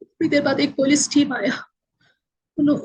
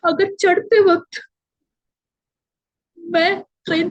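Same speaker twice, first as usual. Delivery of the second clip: voice breaking; 3 semitones higher than usual. Real, not acted. Recalling near death, voice breaks.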